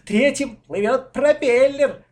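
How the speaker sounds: high, loud, drawn-out cheer; delighted. A narrator's reading. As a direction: crow happily over your boat. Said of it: "обрадовался Коля".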